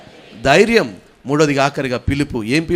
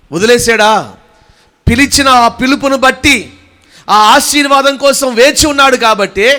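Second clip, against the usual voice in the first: high, loud proclamation; full-force preaching; 8 semitones higher, 8 dB louder than usual. Real, not acted. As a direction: drive each declaration out to the whole congregation. (గొంతెత్తి ఉద్వేగంగా ప్రసంగం)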